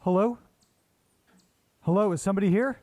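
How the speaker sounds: wary hello into the dark; nervous calling out. Real, not acted. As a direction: call out, unsure anyone is there. Calling out into empty house.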